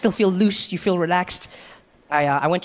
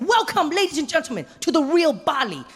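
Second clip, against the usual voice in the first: much higher, sing-song guide voice; cheery tour guide; 8 semitones higher than usual. Retold or imitated. Tour guide introducing himself to tourists, chirpy and excited. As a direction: lead the group with eager excitement. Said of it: "he's like".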